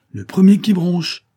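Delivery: loud, high, slow warning; metallic voice. A narrator's reading. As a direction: hurl the threat with a metallic ring. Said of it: "lança-t-il d'une voix métallique".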